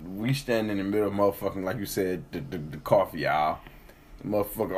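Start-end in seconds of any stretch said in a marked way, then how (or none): none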